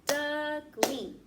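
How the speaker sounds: Duckling split into two loud beats, duck and ling; clapped in beats. Shown as a demonstration. Teacher clapping syllables for kids, loud and clear. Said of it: "Let's try again".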